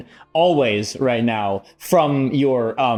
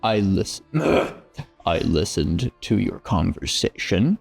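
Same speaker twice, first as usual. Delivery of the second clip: low gravelly patron voice; 5 semitones lower than usual. Acted. Patron voice continues.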